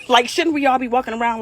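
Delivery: ordinary delivery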